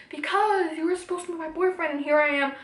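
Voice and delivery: acted-out lovers' quarrel; retold indignation. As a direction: throw the reason back at the boyfriend. Retold quarrel, explaining her anger.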